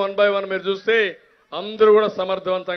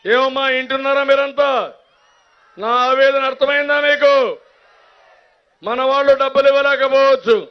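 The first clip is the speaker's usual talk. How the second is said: rousing shout; 6 semitones higher, 4 dB louder than usual. Real, not acted. Rally speaker addressing crowd.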